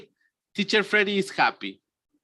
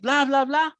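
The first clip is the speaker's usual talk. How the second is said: high, happy blah-blah; mock cheerful chatter; 8 semitones higher than usual. Shown as a demonstration. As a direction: babble happily in a raised pitch. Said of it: "I change from happy".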